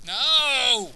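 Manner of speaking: drawn-out, high-pitched "no"; outburst. Real, not acted. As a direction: burst out with a long, high no. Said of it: "I apologize for my outburst".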